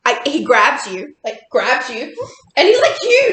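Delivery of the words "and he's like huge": high, loud, laughing through it; giggling at how big he is. Real, not acted. Describing Tony grabbing her, laughing.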